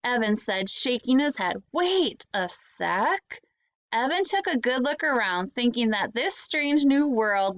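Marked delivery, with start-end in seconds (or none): 1.7-3.4 slow realisation. Acted. Evan realises something.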